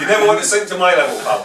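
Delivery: laughing playful dig; laughing jab. Real, not acted. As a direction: tease him while laughing. Jokey jab amid group laughter.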